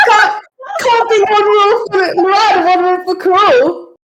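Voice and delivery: high, heated protest; indignant shout. Acted. Bather arguing with companion over who was in disguise, indignant shouting. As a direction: protest the double standard at high pitch. Acted quarrel, characters shouting in baths.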